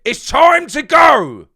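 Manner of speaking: huge, high, slow bellowed shout; Claude's "it's time to go". Retold or imitated. Fan quoting a viral rant, slow high roaring shout. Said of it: "in the words of Claude from Arsenal Fan T V".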